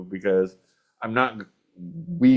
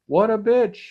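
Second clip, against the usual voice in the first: retold exasperation; 11 semitones higher than usual. Retold exasperated thought about driver.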